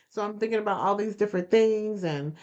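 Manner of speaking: ordinary talk, nothing marked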